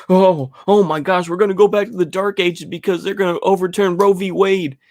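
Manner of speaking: high, put-on fretting voice; mock panic. Mocking liberals over Roe v. Wade in a high, panicky put-on voice. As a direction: whine it out like a hysterical caricature.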